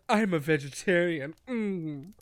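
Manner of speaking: a hint of a fussy cat character. Retelling the book's cat to a podcast cohost, lightly voiced cat. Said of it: "the cat talks like this".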